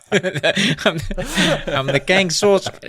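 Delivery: put-on high British accent breaking into laughter; botched British accent. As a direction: fight the giggles in bad British. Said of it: "let's try to do British again".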